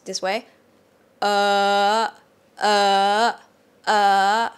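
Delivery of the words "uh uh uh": "uh" in male pitch, louder; male high tone. Shown as a demonstration. Teacher shows men's high tone, no falsetto. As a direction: keep the high tone in a male register. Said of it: "For guys, I usually do this".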